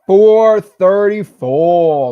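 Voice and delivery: long, loud, high hype call; excited drawn-out shout. Real, not acted. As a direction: stretch it out like a cheer. Celebrating member count live.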